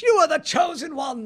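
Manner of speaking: high, loud, despairing cry; anguished Obi-Wan shout. Shown as a demonstration. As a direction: shout it as a heartbroken mentor. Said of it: "he has the speech".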